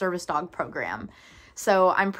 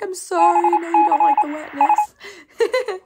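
high, loud mock apology to a pet; cooing apology; 9 semitones higher, 6 dB louder than usual. Owner apologising to the cat she sneezed on, high cooing apology. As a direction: gush an exaggerated, high-pitched sorry to the cat. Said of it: "I felt so bad. I'm like".

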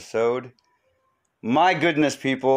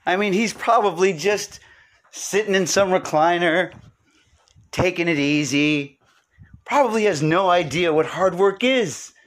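mock scornful viewer; 9 semitones higher than usual. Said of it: "You'd be like".

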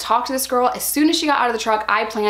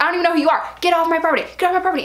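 retold screaming; 7 semitones higher, much faster than usual. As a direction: yell her off the property. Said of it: "And she's screaming at me".

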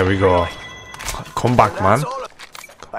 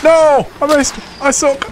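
frustrated yelling. Real, not acted. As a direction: yell in real frustration. Missed shot, self-blame.

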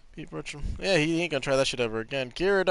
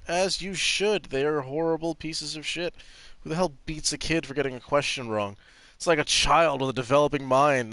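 normal talking voice

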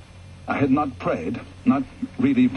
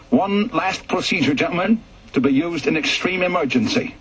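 higher, formal instructor voice; officer lecturing the pilots on emergency procedure, formal and raised; briefing officer; 8 semitones higher than usual. A narrator's reading. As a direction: read it out like an RAF briefing officer addressing pilots.

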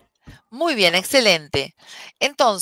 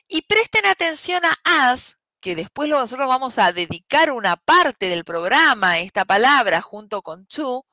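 ordinary talk

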